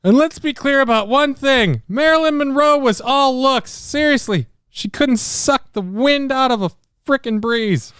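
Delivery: put-on high, cheery old showman voice; chirpy old star bragging; old entertainer voice.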